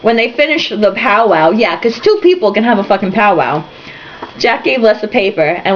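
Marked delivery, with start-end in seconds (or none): none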